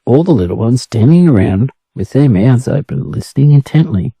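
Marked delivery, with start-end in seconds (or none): none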